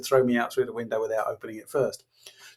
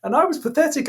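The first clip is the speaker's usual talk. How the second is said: usual speaking voice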